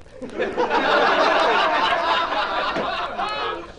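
high drawn-out laugh; high laugh at his own joke; long laugh. Real, not acted. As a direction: let a long laugh roll out.

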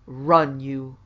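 very loud burst on the first word; rough roar. A narrator's reading. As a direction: bark the order, then drop off. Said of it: "dinned a rough roar".

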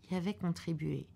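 plain reading voice

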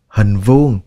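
ordinary talk, nothing marked

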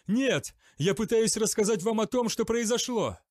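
high, loud pleading denial; desperate shout. A narrator's reading. Accused knight shouts his denial at interrogators. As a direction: shout to be heard.

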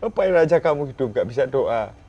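loud angry scolding; mother's scolding. Retold or imitated. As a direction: scold him like a furious mother. Mother scolding in retold story.

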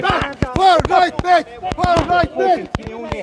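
high, slow, drawn-out yell; slow shouted taunt. Real, not acted. Protester slowly chanting far right at the streamer. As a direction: stretch each word out like a chant.

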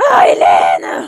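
loud exasperated yell of the name; exasperated shout. Real, not acted. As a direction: call her out, fed up. Exasperated player yelling at Sim. (crier son nom, excédée)

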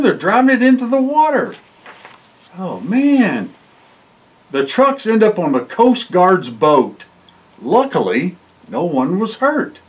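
ordinary delivery, nothing marked

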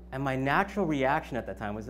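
usual speaking voice

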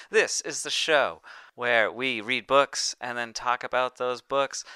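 slow, loud podcast host pitch; show host intro. Acted. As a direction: sell the show to the listeners.

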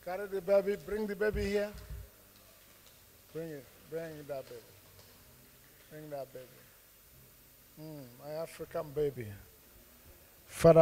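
usual speaking voice

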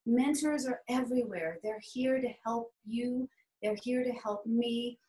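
ordinary talk, nothing marked

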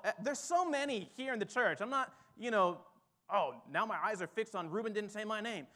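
normal talking voice

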